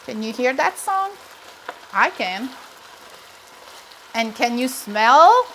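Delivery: usual speaking voice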